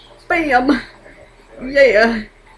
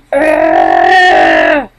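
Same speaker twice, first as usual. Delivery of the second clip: mock groan; 9 dB louder than usual. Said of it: "like, all, like".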